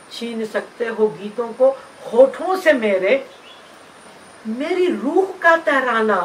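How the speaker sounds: emphatic, rhythmic verse with rises and pauses; defiant poem recitation. Acted. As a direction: recite it as a challenge, phrase by phrase. (चुनौती भरी नज़्म का लयदार पाठ)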